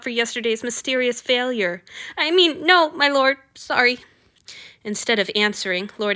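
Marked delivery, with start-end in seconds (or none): 2.2-4.0 higher, rushed nervous correction; flustered. A narrator's reading. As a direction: stumble over the apology. Flustered servant correcting himself.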